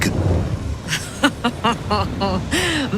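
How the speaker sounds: mocking laugh; scornful laugh. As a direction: laugh off his threat.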